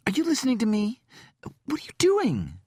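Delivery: panicked. Acted. Mother panicking at the killer.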